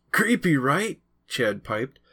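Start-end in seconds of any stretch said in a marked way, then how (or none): 0.1-1.0 high, eager creepy question; excited. A narrator's reading. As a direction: pipe it up with a wide grin. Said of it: "his eyes wide with excitement".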